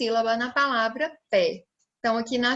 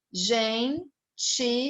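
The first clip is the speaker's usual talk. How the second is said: GENTILEZA counted out as gen-ti-le-za; four separate beats on gentileza, pauses between; split into syllables; slower than usual. Shown as a demonstration. Teacher counting along with children, syllable by syllable. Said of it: "vamos contar comigo".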